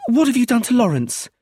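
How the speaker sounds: high, loud panicked demand; frantic high-pitched question; distraught outcry. A narrator's reading. Hysterical Brenda confronts arresting officer.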